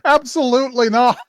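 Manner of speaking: high loud embarrassed refusal; flustered denial. Retold or imitated. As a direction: protest it, a touch flustered. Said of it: "she's just like".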